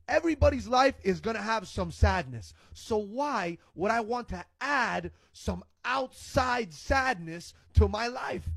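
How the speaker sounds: ordinary delivery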